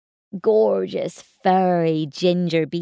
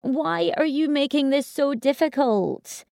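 exasperated; 9 semitones higher than usual. Acted. Mother exasperated with toddler.

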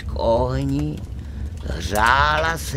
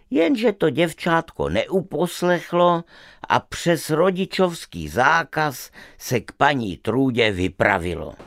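ordinary delivery, nothing marked

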